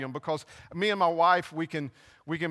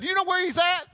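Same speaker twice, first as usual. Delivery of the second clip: much higher, louder urgent shout; frantic Mary; 13 semitones higher, 4 dB louder, faster than usual. Retold or imitated. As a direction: blurt it out, brushing past the angels. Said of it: "this is what Mary Magdalene said".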